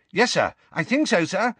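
high, eager obedient reply. A narrator's reading. Junior assistant answering the minister, eagerly. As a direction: answer keenly, anxious to please. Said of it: "said Percy eagerly".

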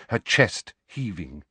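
ordinary delivery, nothing marked

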